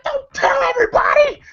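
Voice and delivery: very high-pitched yelled protest; mock protest yell. Movie character telling Pop off over his bathroom trouble, high mock yell. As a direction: shout it in comic indignation. Embarrassed retort in movie scene.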